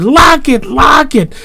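high terrified screams; screaming. A narrator's reading. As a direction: scream it twice in panic. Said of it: "The boys screamed".

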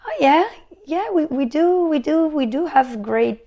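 as if it just occurred to you; dawning realization. Retold or imitated. Acting out her dawning realization.

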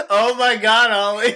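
loud high shocked outburst; shocked laugh. Real, not acted. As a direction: react loud, caught off guard. Shocked reaction to joke.

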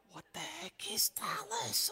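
high, soft, slow incredulous question; puzzled falsetto; baffled youngster. Acted. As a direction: squeak it quietly in mock confusion. Voices baffled younger listeners.

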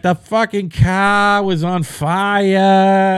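put-on slow, drawn-out thick Boston accent, pitched high; mock a local Boston TV witness, slow and high; Boston news accent. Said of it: "somebody comes on and they're like".

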